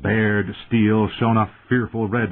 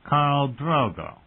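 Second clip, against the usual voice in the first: put-on high, slow drunken courtesy; thick, almost polite; 6 semitones higher, slower than usual. A narrator's reading. Drunken brother addresses the Khal, thickly and almost politely. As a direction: draw it out thickly, feigning politeness. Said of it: "he said thickly, his voice almost polite".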